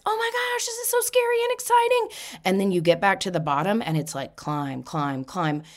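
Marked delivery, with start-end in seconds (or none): 0.1-2.1 much higher, excited squeal of a rider; thrilled squeal. Retold or imitated. Rider at the top of the roller coaster, squealing with thrill. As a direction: squeal like you're at the top of the drop.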